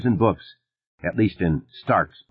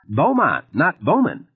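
flustered deputy; 6 semitones higher than usual. A narrator's reading. Flustered deputy correcting himself.

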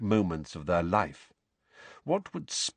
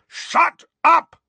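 very high, loud roar; 10 dB louder than usual. A narrator's reading. Uncle and aunt silencing Harry, furious roar. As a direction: roar to cut him off. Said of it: "roared Uncle Vernon and Aunt Petunia".

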